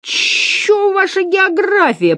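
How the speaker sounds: high, slow, drawn-out sneer; high-pitched scornful pupil voice; contemptuous hiss. A narrator's reading. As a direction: hiss it scornfully, stretching the words. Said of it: "презрительно прошипел Градусов".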